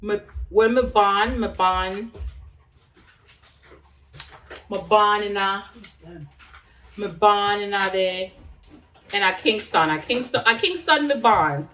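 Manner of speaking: speaking Jamaican patois with a lift on "a Kingston". Shown as a demonstration. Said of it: "I'm supposed to be saying it in Patois".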